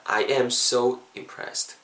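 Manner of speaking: very slow, "am" stressed; slow praise. Shown as a demonstration. Teacher slowly praises students after practice. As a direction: stretch out the praise. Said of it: "teacher praises students".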